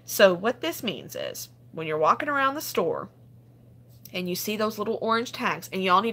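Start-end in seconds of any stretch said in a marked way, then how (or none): none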